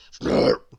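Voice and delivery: very low, rough snort; bull bellowing snort. Acted. Bull in the tangled heap, bellowing snort. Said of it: "bellowed the bull".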